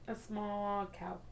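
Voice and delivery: soft awed murmur. Real, not acted. Awed choice, quiet and slow.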